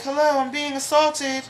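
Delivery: loud, high-pitched indignant cry; exasperated emergency caller. Acted. Caller to nine one one operator, loud exasperation.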